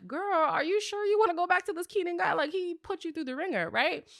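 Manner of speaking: put-on high, concerned girlfriend voice; imitating the women's worried question; mock concerned girlfriend. The women asking K K whether she's sure, mock concerned.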